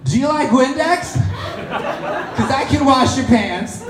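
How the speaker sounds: high-pitched panicky blurt of a pickup line. Acted. Awkward man approaching a girl at a party, blurted out. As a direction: blurt it out far too high. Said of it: "I blurt out".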